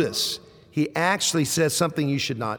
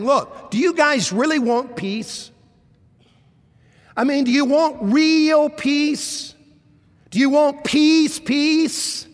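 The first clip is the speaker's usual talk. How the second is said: high, insistent challenge building to a loud "peace, peace"; emphatic appeal; 8 semitones higher, 4 dB louder than usual. Retold or imitated. Preacher voicing Isaiah's appeal to the people, emphatic and insistent. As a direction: press harder on "real peace", then double "peace", pitched way up. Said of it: "It's almost like he's saying".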